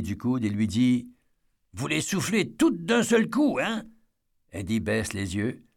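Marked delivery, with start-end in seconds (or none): 1.7-3.6 put-on a kid daring the grown-up; child voice. A kid nudges Eddie. 3.6-3.8 put-on short, high kid's hein rising; child voice. A kid nudges Eddie.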